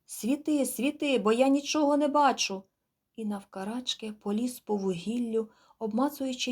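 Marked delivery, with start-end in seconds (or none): 0.0-2.6 high, loud call toward the cabin; shout. A narrator's reading. Man on the barge shouting to the cabin for light. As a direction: yell for more light in the dark. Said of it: "чолов'яга закричав до рубки".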